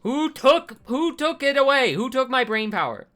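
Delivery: high, loud exasperated wail; frustrated outcry. Real, not acted. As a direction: cry out at your own brain. Frustrated gamer cries out.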